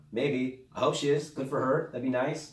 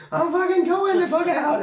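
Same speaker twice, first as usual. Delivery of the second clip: very high, much louder yell, laughing through it; shouting back; 10 dB louder than usual. Retold or imitated. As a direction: yell back in a panic while cracking up. And I'm like.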